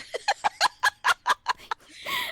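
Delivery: a burst of squeaky laughter; nervous giggle before the story; high giggle. Real, not acted. Giggling at the awkward setup.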